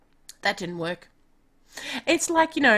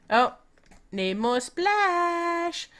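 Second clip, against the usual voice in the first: slow musing; 7 semitones higher, much slower than usual. Real, not acted. Musing to herself while playing.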